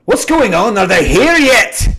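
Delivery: loud and high, called out from behind; gruff voice from the back. Acted. The old dwarf calls from the back, loud and high. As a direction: bellow it from the back room. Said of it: "you hear a voice go".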